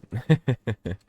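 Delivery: short loud laugh; a quick real laugh; burst of laughter. Real, not acted. Laughs at fooling around.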